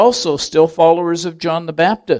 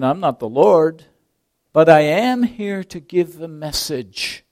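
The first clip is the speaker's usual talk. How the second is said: John the Baptist; 3 semitones higher, 6 dB louder, slower than usual. Acted. Preacher voicing John the Baptist.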